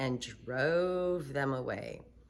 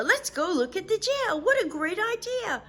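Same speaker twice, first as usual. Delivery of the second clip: put-on high delighted girl voice; eager little girl; Sheila's voice; 4 dB louder than usual. Sheila thrilled by jail idea.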